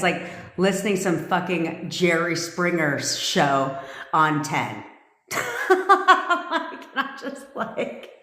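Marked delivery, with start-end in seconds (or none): 5.3-8.1 high laugh into slow, breaking-up words; real cracking up. Real, not acted. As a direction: laugh so hard the words barely come out. Laughing at her Jerry Springer comparison.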